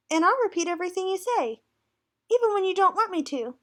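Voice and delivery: high, quick parrot voice; chattering parrot. Acted. Parrot bragging to the class, fast and high. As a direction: rattle it off like a parrot.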